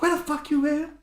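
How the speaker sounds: high angry demand; angry mom. Retold or imitated. Mom on the phone chewing out her son, high and sharp.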